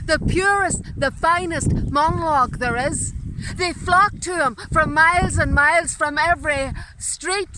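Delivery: loud, high theatrical oratory; stage actor's booming recital; grand declamation. Acted. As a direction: proclaim it slowly to the back of the hall. Grand retelling with recited Hamlet.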